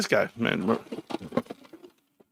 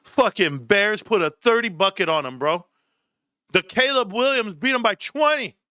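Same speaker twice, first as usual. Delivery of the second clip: usual speaking voice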